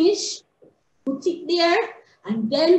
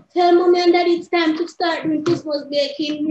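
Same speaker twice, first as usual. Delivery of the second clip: ordinary delivery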